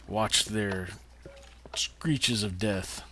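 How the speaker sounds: drawn out, savoring each word; slow relish. Acted. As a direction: relish it slowly. Relishing mobs dying.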